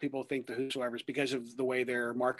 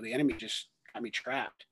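ordinary talk, nothing marked

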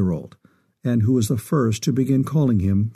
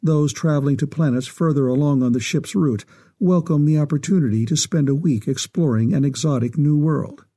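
ordinary delivery, nothing marked

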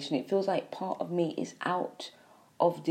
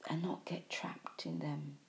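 slow, hushed warning whisper; 3 semitones lower, 9 dB quieter than usual. Real, not acted. Warning the listeners.

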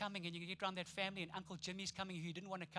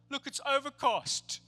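slow, loud, higher whiny complaint; mock moaning; 6 semitones higher, 8 dB louder, slower than usual. Gloomy person grumbling about north coast rain, slow whiny moan. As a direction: sulk about the weather, dragging each word.

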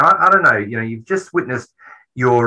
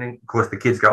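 normal talking voice

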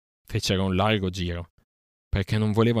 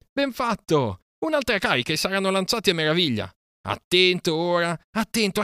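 excited shouting; 10 semitones higher than usual. Acted. Mowgli urging Akela on during the stampede, excited high shouting. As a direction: yell encouragement mid-chase. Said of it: "gridò Mowgli".

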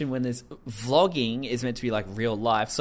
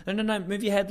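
higher-pitched mock coaching; rapid-fire imitation of stage directions; imitated instructing; 8 semitones higher, faster than usual. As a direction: urgently repeat it, imitating a bossy director. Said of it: "hear her instructing him".

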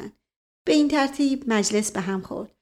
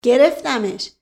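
short, louder exclamation; triumphant cry; 3 semitones higher, 6 dB louder, slower than usual. A narrator's reading. Man pretending to catch a mouse, crying out. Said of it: "فریاد زد".

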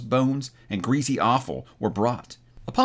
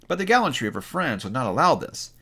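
ordinary delivery, nothing marked

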